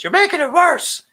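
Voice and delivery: high, loud yelled impression; imitated outburst. Host quoting a favorite show drop, high mock yell. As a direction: yell it way up high, mimicking him. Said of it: "that one where he goes".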